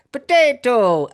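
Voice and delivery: potato shouted high and loud; mock shout. Boss shouting at the Irish bookkeeper, hammed-up yell. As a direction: bellow potato like the boss did. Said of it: "He repeatedly shouted".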